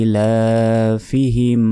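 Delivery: ইলাফিহিম slow, long ee and laa; measured chant of ইলাফিহিম; tajweed elongation. Shown as a demonstration. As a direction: hold the first ee and the laa of ইলাফিহিম. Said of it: "লাতেও এক second টান হবে".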